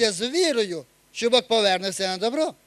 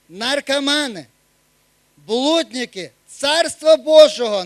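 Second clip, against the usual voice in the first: louder, slow, hammering each sin; forceful preaching; 6 dB louder than usual. Acted. Preacher warns the church about sinners, forceful proclamation. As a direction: thunder the verdict at the congregation.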